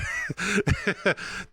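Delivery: a sudden high squeal of laughter; real high-pitched laugh. Real, not acted. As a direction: burst into a high giggle. High laugh at Luca's jab.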